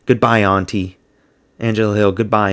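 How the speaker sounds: normal talking voice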